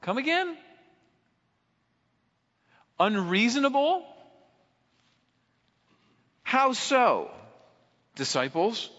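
woman answers the disciples in high incredulous tones. Retold or imitated. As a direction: throw their word back at them in disbelief. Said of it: "maybe she would say".

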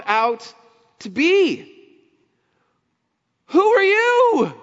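high, loud, indignant challenge; incredulous religious leaders. Retold or imitated. Temple leaders confronting Jesus, high and outraged. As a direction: demand it like offended temple elders.

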